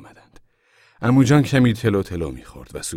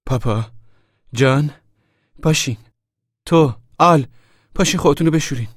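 high, loud wake-up call; 9 semitones higher, 8 dB louder than usual. A narrator's reading. Mother wakes the family in the tent, calling out. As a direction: call each sleeper by name, voice raised. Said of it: "صدا زد".

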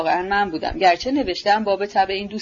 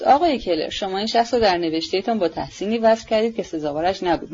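plain reading voice

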